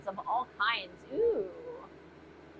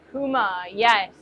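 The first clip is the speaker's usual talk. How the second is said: ordinary delivery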